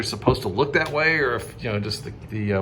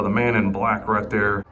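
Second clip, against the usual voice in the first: ordinary talk, nothing marked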